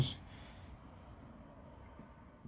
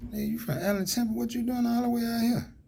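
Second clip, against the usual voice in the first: surprised greeting; 12 semitones higher than usual. Retold or imitated. Surprised greeting of a displaced neighbor.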